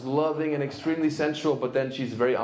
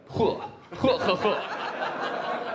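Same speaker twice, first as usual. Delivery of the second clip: mock disgusted laugh; 6 semitones higher, much slower than usual. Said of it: "They were like".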